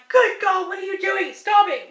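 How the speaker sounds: high, loud scolding shout; mother yelling at her eight-year-old. Retold or imitated. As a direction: yell at the child to stop. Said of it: "And I'm like".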